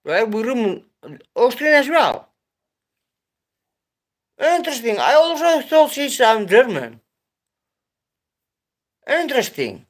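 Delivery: high, amazed repeats of interesting; real surprise, pitch way up; surprised. Real, not acted. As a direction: marvel at the news. Second viewer confirms Austrian.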